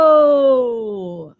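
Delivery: mild loud hello; as loud as we can. Shown as a demonstration. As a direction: call hello out. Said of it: "Let's say hello as loud as we can".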